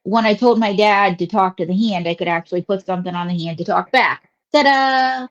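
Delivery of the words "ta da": bright, showy reveal; triumphant ta-da. Acted. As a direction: present it like a magic trick. Presents the talking hand puppet.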